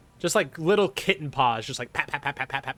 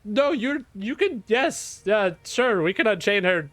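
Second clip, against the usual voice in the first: high, bright know-it-all; innocently quizzing the players; high-pitched clueless humanoid; Tech, curious and oblivious; 7 semitones higher than usual. Acted. GM plays Tech.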